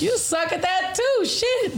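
giggling as the words come out; laughing. Real, not acted. Laughing back at a friend's teasing, giggling.

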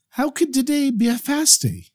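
high-pitched, puzzled disbelief; bewildered. A narrator's reading. Farmer puzzled by news of a fast day, bewildered. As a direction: question it in high-voiced bewilderment. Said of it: "he didn't understand this".

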